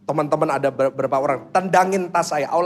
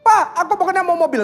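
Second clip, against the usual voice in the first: put-on higher, insistent kid voice in a tantrum; tantrum child; 13 semitones higher, 3 dB louder than usual. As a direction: demand it like a crying child. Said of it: "Dia sampai nangis gulung-gulung".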